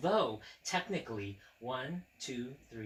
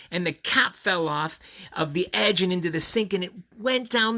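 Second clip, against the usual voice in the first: a louder, lively read-aloud; story narration; 7 semitones higher, 11 dB louder than usual. A narrator's reading. Reading the toothpaste mishap aloud to children. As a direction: tell it brightly to little listeners.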